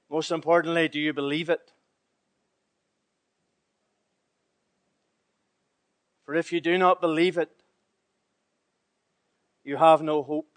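quiet earnest sermon; hushed preaching. Acted. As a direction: draw them in with a hushed plea. Preacher drops to intimate tone.

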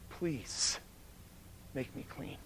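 a soft plea that sinks into a breathless whisper; fading plea. Retold or imitated. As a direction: let the prayer die away to nothing. Woman pleading, fading to whisper.

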